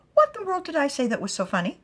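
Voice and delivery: high, loud mock shock; high, loud affronted surprise; shocked mother. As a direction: sound baffled and affronted. Glyneth shocked.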